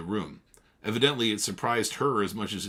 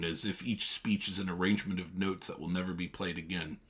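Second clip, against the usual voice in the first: ordinary delivery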